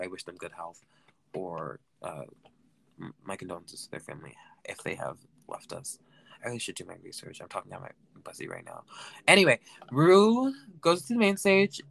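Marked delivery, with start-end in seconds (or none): 0.0-8.8 hushed, unvoiced mutter; whispered aside. Real, not acted. Host muttering condolences to listeners, whispered. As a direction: whisper it under your breath.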